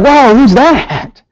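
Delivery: high, slow, wide-eyed mock surprise; startled baby. Baby hearing a stranger's footstep, high and slowly startled. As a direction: play the baby caught off guard. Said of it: "that baby will be like".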